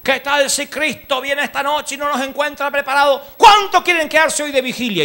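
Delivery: a pastor's high, slow, drawn-out appeal; pastor calling the flock. Retold or imitated. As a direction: call the church to stay for the vigil. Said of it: "Un día el pastor dice". (pastor llamando a la vigilia, agudo y lento)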